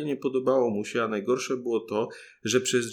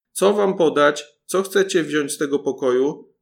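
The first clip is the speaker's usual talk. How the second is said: louder, higher yell of the same question; even louder shout; 4 semitones higher, 9 dB louder than usual. A narrator's reading. Paluszek shouts louder on purpose to wake the cook, full yell. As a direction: bellow it so the whole house hears. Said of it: "krzyknął jeszcze głośniej".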